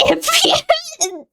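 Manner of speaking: laughing through the words; slow, giggling confession. Real, not acted. Laughing as she admits to the chat she was on Ambien.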